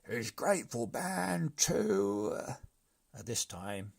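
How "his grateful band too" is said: much higher squeaky brigand chorus; brigands' voice. A narrator's reading. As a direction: chime in, high. Brigands adding slowly.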